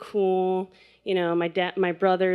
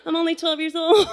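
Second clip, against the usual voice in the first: high, frantic and helpless; mock panic, voice up high; panicked kid; 8 semitones higher than usual. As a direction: squeal like a scared twelve-year-old. Panicked kids in mudslide.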